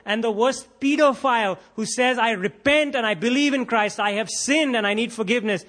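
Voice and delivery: fervent preaching. Acted. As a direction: preach it with rising fervour. Preaching at full force.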